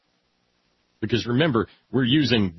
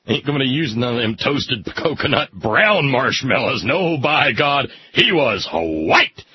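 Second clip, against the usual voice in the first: mock bigot rant. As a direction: ham up an indignant bigot. Mock redneck preacher rant.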